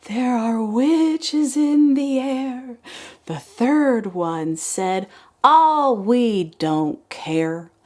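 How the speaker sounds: slow, sing-song poem reading; kindergarten teacher voice. Acted. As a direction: read it like circle time. Reads poem again.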